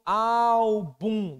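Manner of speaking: "álbum" with a long, loud ál; first-syllable stress. Shown as a demonstration. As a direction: draw out ál in "álbum". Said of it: "A correlação, ah, da minha acentuação". ("álbum" com a primeira sílaba longa e forte)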